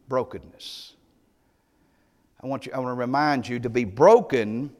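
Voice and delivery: ordinary delivery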